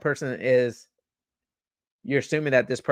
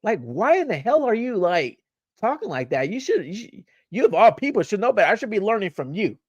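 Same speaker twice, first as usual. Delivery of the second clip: much higher, mock-outraged rant at an elder; incredulous outburst; 8 semitones higher than usual. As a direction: scold the old head in disbelief, pitched way up. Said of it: "I'm like".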